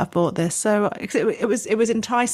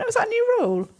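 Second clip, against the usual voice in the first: surprised; faster than usual. Retold or imitated. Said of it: "And I went".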